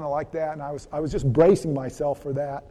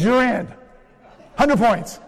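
louder, higher happy announcement; joyful welcome; 5 semitones higher, 5 dB louder, slower than usual. Retold or imitated. Saint Peter welcoming the accountant in, loud and joyful. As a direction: throw the gates open with joy. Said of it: "And Peter goes".

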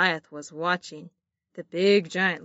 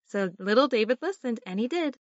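plain reading voice

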